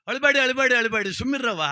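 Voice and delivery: high, loud call to stop crying; loud hushing. Acted. Dasappa loudly tells the wailing women to stop crying.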